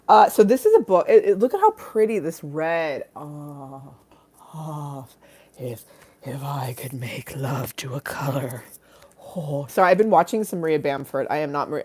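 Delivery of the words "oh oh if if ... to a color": breathy whispered swoon, slow. Pretend. Streamer swooning over a pretty red cover, whispered. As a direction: whisper it in rapture.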